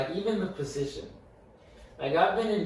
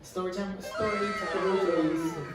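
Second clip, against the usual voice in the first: drawn-out story time announcement; 5 semitones higher, slower than usual. Acted.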